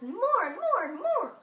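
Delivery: rising wonder as the crowd swells; building excitement. A narrator's reading. Oysters pile up.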